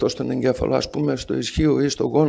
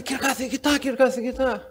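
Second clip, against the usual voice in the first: higher mock call across the street; 9 semitones higher than usual. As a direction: call out to the professor, pitched up, sing-song. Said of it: "Πάει απέναντι".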